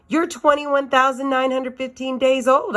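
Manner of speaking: high, eager announcement; excited shout. A narrator's reading. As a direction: blurt it out proudly. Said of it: "I shouted".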